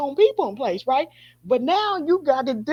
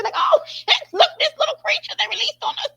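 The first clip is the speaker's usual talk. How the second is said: mock alarm; 13 semitones higher than usual. Host mimicking people spotting the creature, mock-alarmed and laughing. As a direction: exclaim like alarmed onlookers, half laughing.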